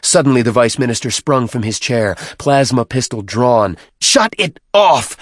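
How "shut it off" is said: high, loud, stretched-out yell; piercingly raised threat; screamed command. A narrator's reading. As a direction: scream the order in fury. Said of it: "he screamed".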